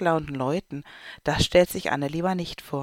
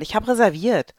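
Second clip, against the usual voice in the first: louder, higher insistence; pushy raised voice; insistent call; 5 semitones higher, 5 dB louder than usual. Acted. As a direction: claim your seat out loud. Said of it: "ruft die Frau".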